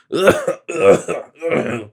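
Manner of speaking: harsh, drawn-out coughing; racking cough. A narrator's reading. Sick miser coughing while the stranger talks. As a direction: cough like it tears the chest.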